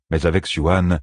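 ordinary delivery

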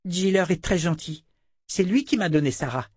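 put-on high, simple child's voice; little girl. A narrator's reading. Girl vouches for her friend, high child voice.

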